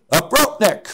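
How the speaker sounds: put-on loud, high Swiss-accented call; mock shout in a Swiss accent. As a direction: imitate a Swiss climber hollering up from below. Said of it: "I hear this voice saying".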